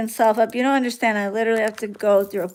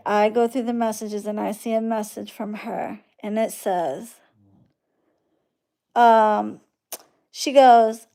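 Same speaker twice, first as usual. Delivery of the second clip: usual speaking voice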